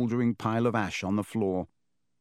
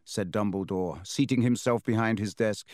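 ordinary delivery

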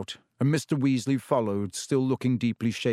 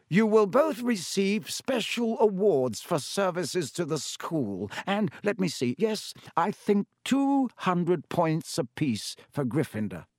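slow, lightly voiced old wizard, higher; Dumbledore, pleased; 6 semitones higher than usual. A narrator's reading. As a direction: savour the good news slowly. Dumbledore announces awards.

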